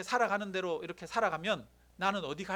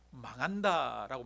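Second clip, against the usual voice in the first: drawn out 망한다, each syllable slow and low; low, deliberate pronouncement; slow emphatic verdict; 7 semitones lower, slower than usual. Shown as a demonstration. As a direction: lay slow weight on 망한다. Preacher stresses God's verdict.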